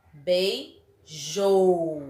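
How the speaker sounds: "Beijou" slowly split, rising BEI, long falling JOU; stress on JOU. Shown as a demonstration. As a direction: say "Beijou" as bei... jooou for a class. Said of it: "atenção à pronúncia". ("Beijou" silabado, alongando o JOU)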